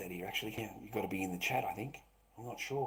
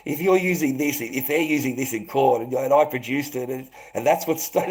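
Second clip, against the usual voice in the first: loud, raised-pitch sweary rant; angry outburst; 6 semitones higher, 12 dB louder than usual. Real, not acted. Streamer rants about them using his words in court, angry outburst. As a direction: shout it in outraged defiance.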